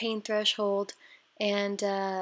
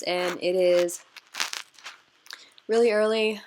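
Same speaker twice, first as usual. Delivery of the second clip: yawning; 5 dB louder than usual. Real, not acted. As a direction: talk while stifling a yawn. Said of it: "sorry if I keep yawning".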